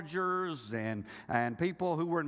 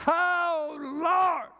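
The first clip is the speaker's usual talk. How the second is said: higher, louder mock wail to the Lord; mock panicked cry; 10 semitones higher, 7 dB louder than usual. Worker hears of downsizing, cries out in mock panic. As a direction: cry out like the sky just fell.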